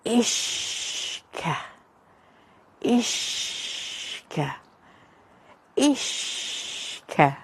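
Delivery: slow, drawn-out whispers like waves; whispered. Shown as a demonstration. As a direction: whisper it like the sea lapping the shore. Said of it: "it sounds like the sea kissing the edge of Eire".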